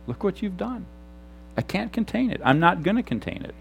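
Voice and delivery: high but hushed exclamation; held-down excitement, high and very soft; soft celebrating. Acted. Acting out celebrating to God.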